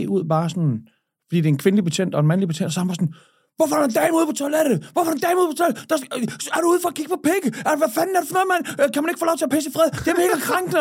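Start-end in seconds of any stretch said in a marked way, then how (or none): 3.6-10.8 high, fast, indignant squawk; high-pitched hammed-up indignation; mock outrage. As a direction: play a scandalised complainer, rattling off protests. Acting out friend's outrage at police.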